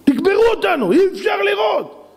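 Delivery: high, shouted plea; acted anguished shouting; desperate cry. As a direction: cry out to the mountains in despair. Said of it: "מרוב צרות אנשים יגידו להרים".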